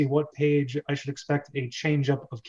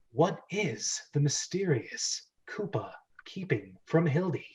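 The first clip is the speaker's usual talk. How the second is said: hushed, breathy teaser whisper; mysterious whisper; 6 dB quieter than usual. Acted. Host ending the dramatic recap with a hushed whisper.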